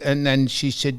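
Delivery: ordinary talk, nothing marked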